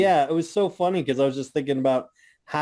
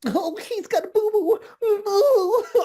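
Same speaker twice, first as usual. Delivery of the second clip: much higher, babyish mock-pity voice; mocking baby talk; slower than usual. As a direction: coo it like pitying a toddler, sneering. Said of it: "And then they go".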